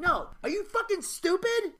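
high-pitched exasperated outburst; acted indignant scolding; blunt scolding. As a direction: throw it out in disbelief, pitch climbing. Acts out real-life scolding.

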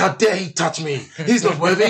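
high-pitched indignant cry, played for laughs; mock outrage. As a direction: retell being hugely affronted. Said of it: "it pissed me off so much".

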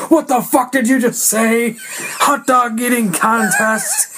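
retold indignation, stretched out at the end; pissed off. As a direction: imitate an indignant man taking offense. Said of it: "is so pissed off".

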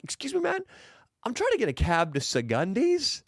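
high innocent put-on voice; fake polite request. Speaker sets up the prank on the taxi guy, high innocent voice. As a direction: sound sweet and naive, pitch up. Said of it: "I'm like".

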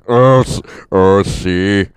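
loud, slow gasping hammed-up voice; winded player, second take. Heavy player begging for oxygen, loud and gasping. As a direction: gasp for oxygen, dragging the words. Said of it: "it wouldn't sound like that".

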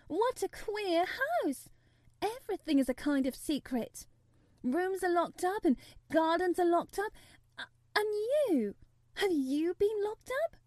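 put-on very high, wondering girl's voice; amazed young girl. A narrator's reading. Girl to boy about the secretive house, amazed.

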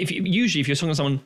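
ordinary talk, nothing marked

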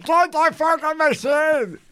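high, slow, drawn-out comic wail; hammed-up desperate pleading, stretching every word; panicked cry for help. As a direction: wail as if winded and dying. Acts himself still wailing for help.

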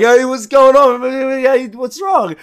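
high, bright hey, what's going on; mock concerned greeting. To his short mate between strippers, high mock concern. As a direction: greet him high and innocently. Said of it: "I'm like".

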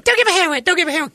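very high whiny falsetto; hammed-up squeaky girl; mock girly whine. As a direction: whine it again and again. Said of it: "he's standing in the pool going".

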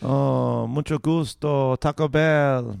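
string Spanish words out with a put-on accent; mock Spanish accent. Showing co-host the Spanish accent, mock Spanish. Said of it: "number eight is Spanish".

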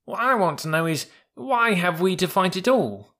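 high, insistent youngster's voice; young mule. Acted. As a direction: pipe up, puzzled and persistent. Young mule asks naive question.

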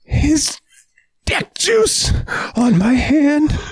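high whiny mock shout. Mocking her disgust at the handshake, high whining cry. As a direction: wail it like a disgusted girl.

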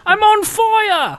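very high, slow mock shout; mock British accent. Clowning for the others in a hammed-up British accent. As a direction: wail it in a silly British accent.

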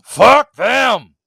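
loud high drawn-out shout; coach's rallying yell. Retold or imitated. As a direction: bellow it like a locker-room speech. Acting out the coach's speech.

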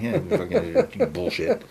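high, laughing, hammed-up indignation; mock outrage. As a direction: play the wronged golfer. Mock outrage at bad golf luck.

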